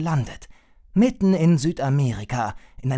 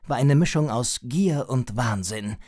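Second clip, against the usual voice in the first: usual speaking voice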